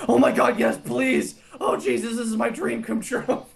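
high, gushing excited fan. Retold or imitated. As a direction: gush like a fan whose dream came true. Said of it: "They're like".

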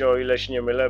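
usual speaking voice

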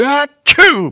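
loud, high "achoo"; acted sneeze. A narrator's reading. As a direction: burst out with a big mock sneeze.

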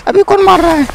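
high startled cry of who is shooting; sudden high panicked question; startled yelp. Real, not acted. Surprised by attacker.

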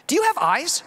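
mock outrage; incredulous retort. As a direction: toss the question back in mock disbelief.

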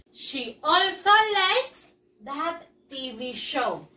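slow, every word clear; slow model sentence. Shown as a demonstration. Teacher modeling a sentence for pupils, slow and clear. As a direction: read it slowly for the class to copy. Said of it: "model sentence for class".

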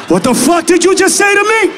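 hammed-up high-pitched outrage; shocked shout. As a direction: shout it in utter disbelief. Outraged reaction to her mispronunciation.

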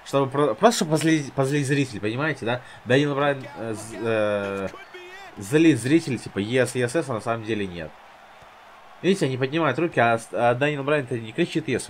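ordinary talk, nothing marked

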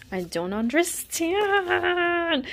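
high, drawn-out wail; long, high comic whine; mock despair. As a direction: wail it out slowly in exaggerated bewilderment. Mock despair over duplicate cards.

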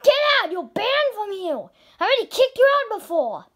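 high, loud furious yelling; angry owner shouting at a banned customer. Acted. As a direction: roar him out of the restaurant.

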